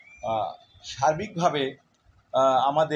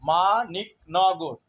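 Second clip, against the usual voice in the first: Maniknagar drawn out slowly and gently; much slower than usual. Shown as a demonstration. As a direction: say Maniknagar slowly to show the syllables. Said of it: "আস্তে বললেও". (মানিকনগর ধীরে ধীরে বলা)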